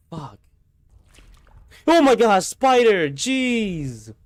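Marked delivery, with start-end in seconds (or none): 1.9-4.1 shrieking high and loud at a spider; real fright, scream it out; startled scream. Real, not acted. Streamer spots a spider in the basement, screaming.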